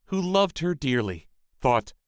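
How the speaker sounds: usual speaking voice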